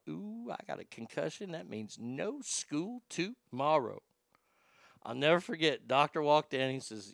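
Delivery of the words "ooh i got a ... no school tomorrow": sly inner thought. Retold or imitated. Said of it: "I think to myself".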